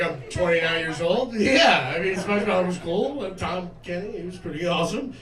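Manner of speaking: ordinary talk, nothing marked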